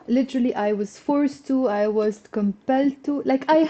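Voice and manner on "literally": ordinary talk, nothing marked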